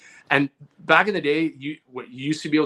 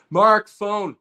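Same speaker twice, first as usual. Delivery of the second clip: calling up the stairs, a bit louder and higher; 6 semitones higher, 7 dB louder than usual. Retold or imitated. Boy calling his brother to the phone, mild call. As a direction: shout a name to someone in another room.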